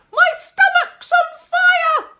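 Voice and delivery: very high, very loud slow wail; grandma screaming for the fire brigade; shout. A narrator's reading. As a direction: bellow it out in a panic. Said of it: "she shouted suddenly".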